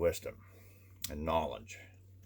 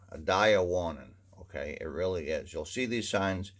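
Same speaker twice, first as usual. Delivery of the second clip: ordinary talk, nothing marked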